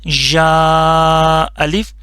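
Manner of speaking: drawn-out syllable reading, slow and clear; slow teacher demo. Shown as a demonstration. Teacher reciting the letter for students, slow and drawn out. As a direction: model the letter name slowly for the class. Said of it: "اور یہ ہو گیا".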